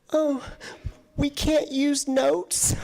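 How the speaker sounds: high, slow wide-eyed innocence; feigned innocence. Retold or imitated. Caught student to teacher, slow high mock innocence. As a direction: play dumb with a slow, high voice.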